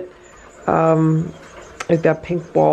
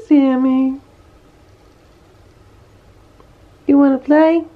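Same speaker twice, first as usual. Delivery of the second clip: sing-song, higher coaxing voice for the cats; high pet talk; 9 semitones higher than usual. Real, not acted. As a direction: coax the cats over like babies.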